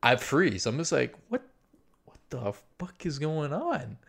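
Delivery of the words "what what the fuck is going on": softer, higher, halting disbelief; stunned question to himself; hushed shock. Retold or imitated. Man frozen at the peephole, hushed shock. Frozen watching neighbour, retold.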